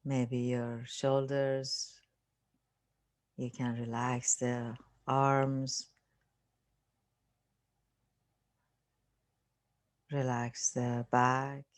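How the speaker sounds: unhurried meditation voice with long pauses; guided meditation. Acted. Teacher leading listeners through relaxing the body, guided meditation. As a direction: calmly guide them through a body scan, leaving space.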